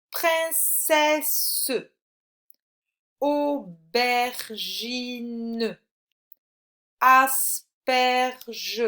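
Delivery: words split into long, separate syllables. Shown as a demonstration. Teacher asks which syllable has è, syllable by syllable. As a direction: sound out each syllable slowly for a pupil. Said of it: "dans quelle syllabe tu entends le son".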